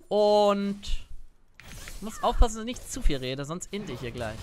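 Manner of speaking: normal talking voice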